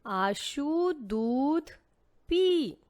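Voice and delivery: deliberate classroom reading; slow sentence read. Shown as a demonstration. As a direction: read it out slowly for the children. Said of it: "पूरा sentence क्या हुआ?".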